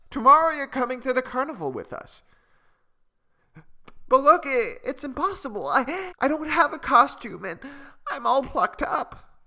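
high and hurried, then halting; fretting little bird. Acted. Malu worrying to her friends she has no costume, high and flustered. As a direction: worry aloud like a shy chick.

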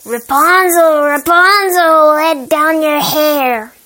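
loud calling witch voice, slow on the last words. A narrator's reading. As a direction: call up the tower like a witch. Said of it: "the witch used to come to the foot of the tower and call".

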